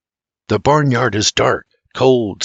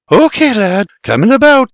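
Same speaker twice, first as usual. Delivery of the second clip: higher, louder lad's-mate call; character calling out; 10 semitones higher, 4 dB louder than usual. A narrator's reading. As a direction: call out to the lad. Character calls out in story.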